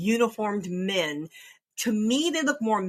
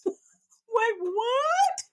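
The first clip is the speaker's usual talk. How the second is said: shocked; 4 dB louder than usual. Retold or imitated. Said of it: "I'm like".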